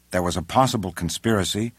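ordinary delivery, nothing marked

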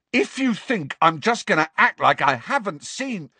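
high, heated voice; angry retort. A narrator's reading. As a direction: argue back indignantly. Acted quarrel, cutting each other off.

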